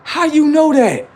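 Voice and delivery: very high, loud squeal; mock astonishment. Comic reacting to the girl agreeing, high loud mock astonishment. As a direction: shoot the question way up in pitch.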